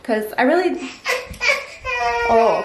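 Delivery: ordinary delivery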